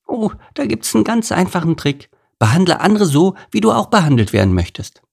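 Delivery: high, bright little bee giving advice; a tiny bee sharing a simple rule; high bee voice, quickening at the end; friendly bee Isabel. A narrator's reading.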